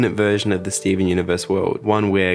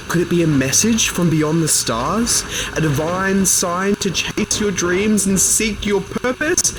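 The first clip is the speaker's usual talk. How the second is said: put-on raised-pitch Australian accent as a live TV news reporter; 4 semitones higher than usual. [name] auditioning as a TV reporter, Australian-accent news read. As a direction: deliver the news bulletin in a put-on Australian accent, higher than your own voice. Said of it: "playing a character with an Australian accent".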